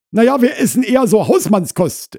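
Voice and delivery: high, loud housewife voice; Frau Krause explaining. Acted. Wife corrects herself for husband, explaining loudly. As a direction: backtrack at high pitch.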